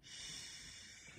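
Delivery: long soft unvoiced shh; a gentle hushing hiss; shushing sound. Shown as a demonstration. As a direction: hush it out like a quiet librarian. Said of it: "it makes that sound".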